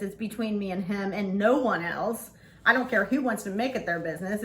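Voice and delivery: ordinary talk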